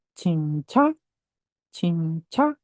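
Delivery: "cinca" split in two, ch sounds in both syllables, rising end; pronunciation demo. Shown as a demonstration. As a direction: model "cinca" slowly, lifting the last syllable. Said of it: "kalau ini dibacanya gimana".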